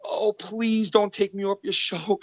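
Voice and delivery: high-pitched mock begging; mock pleading. Podcaster acts the rebuttal his rival expected, mock pleading. Imagined begging reply, mocked.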